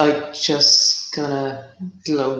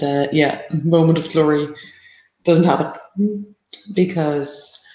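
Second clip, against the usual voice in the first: ordinary talk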